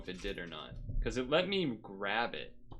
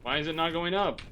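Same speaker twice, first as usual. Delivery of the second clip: annoyed question, a bit louder; asking the game in rising frustration; frustrated; 5 semitones higher, 6 dB louder than usual. Real, not acted. Streamer at the stuck cannon, annoyed.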